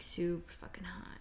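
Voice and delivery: whispered aside; whispering. Real, not acted. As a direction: drop to a whisper. Aside about the heat.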